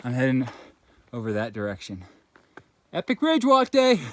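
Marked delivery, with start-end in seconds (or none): 2.9-4.1 excited shout. Real, not acted. Hiker cheers on the ridge.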